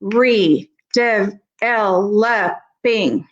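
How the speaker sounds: "re, dev, el, le, bing" broken apart with a pause after each; syllable by syllable. Shown as a demonstration. Teacher showing parents how timing reveals prefixes, "re, dev, el, le, bing" syllable by syllable. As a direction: sound out "re, dev, el, le, bing" piece by piece. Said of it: "I hear that pause there".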